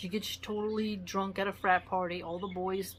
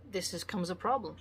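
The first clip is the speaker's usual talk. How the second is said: ordinary delivery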